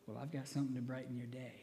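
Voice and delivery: soft and fast, with a chuckle running through it; laughing quietly. Retold or imitated. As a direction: hold back a laugh as you say it. Offers letter to crying girlfriend.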